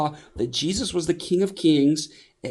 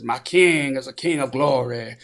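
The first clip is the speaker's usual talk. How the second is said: S M Lockridge impression.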